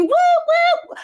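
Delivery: high whooping call out; excited whoop. Real, not acted. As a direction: whoop to greet a friend. Calling out to a viewer.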